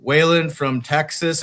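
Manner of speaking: ordinary delivery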